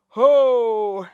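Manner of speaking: loud single whoop; a burst of a cheer; loud whoop to fire up the set; coach whoop. Real, not acted. Coach cheer before final set.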